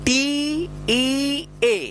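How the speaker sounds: each letter held long; drawn-out, separate letters; slow spelling. Shown as a demonstration. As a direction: spell it very slowly for learners. Teacher spells out word for learners.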